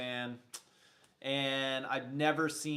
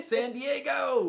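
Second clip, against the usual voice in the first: loud, high, drawn-out holler; calling out; 8 semitones higher, 4 dB louder, much slower than usual. Real, not acted. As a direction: shout the city slowly, stretched out. Hails viewer's city.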